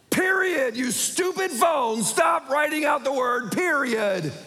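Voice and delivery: high, slow, furious rant at a machine; hammed-up enraged yell; exasperated shouting. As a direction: blow up at the phone, stretching each word. Losing temper at dictation phone.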